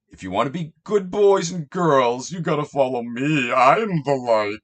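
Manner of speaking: patronising preacher voice; mock Jesus. Parodying Jesus speaking to followers, condescending. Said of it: "In other words".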